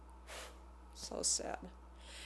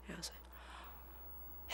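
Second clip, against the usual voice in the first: whisper; 22 dB quieter, much slower than usual. Retold or imitated. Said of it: "whispered aside".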